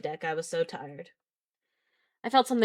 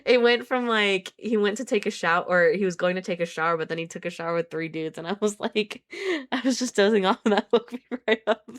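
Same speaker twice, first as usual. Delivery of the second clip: laughing; 4 semitones higher than usual. Real, not acted. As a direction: laugh through the explanation. Laughing while explaining.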